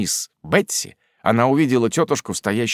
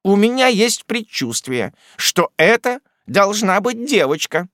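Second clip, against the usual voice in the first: domineering aunt; 9 semitones higher than usual. A narrator's reading. Miss Betsey declaring herself godmother, domineering. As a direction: lay down the law kindly but firmly.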